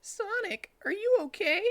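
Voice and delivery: very high, worried little fox; squeaky caring sidekick; Tails voice. Acted. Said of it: "asked Tails".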